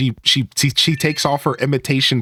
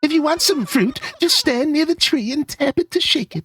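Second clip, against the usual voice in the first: high put-on villager lady voice; chatty high-pitched lady giving helpful advice; Barbara; 13 semitones higher than usual. Villager explaining fruit trees to the player, high lady voice.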